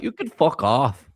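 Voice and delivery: imitating a Canadian accent. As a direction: say it like a riled Canadian. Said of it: "In such a Canadian accent".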